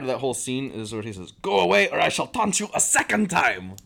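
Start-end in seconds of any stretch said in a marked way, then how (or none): 1.4-3.8 high, loud mock taunt; taunting French guard. Fan quoting the French guy, loud and taunting. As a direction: jeer it like a hammed-up castle guard. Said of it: "he's just taunting them the whole time".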